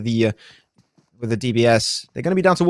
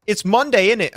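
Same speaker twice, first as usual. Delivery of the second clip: higher-pitched demo of British accent; British accent attempt; 7 semitones higher than usual. Shown as a demonstration. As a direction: show off a British "innit". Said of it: "Can you do it, though?".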